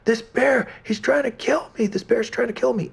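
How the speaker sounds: put-on high, panicky little old man cry; old man flees from the bear, shrieking for help; old man screaming for help. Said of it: "he's screaming".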